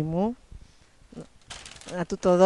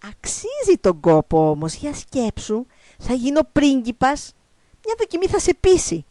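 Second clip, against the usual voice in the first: slow, stretched persuasion climbing higher; coaxing frog; 5 semitones higher, 4 dB louder than usual. Acted. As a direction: talk her round temptingly. Frog coaxes princess to kiss. (πειστικά, τραβηγμένα, παρακαλεστά)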